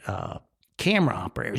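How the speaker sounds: usual speaking voice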